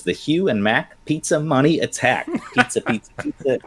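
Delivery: reading through laughter, high; laughing. Real, not acted. Host reading a viewer's pizza tip, laughing. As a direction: giggle your way through the chant.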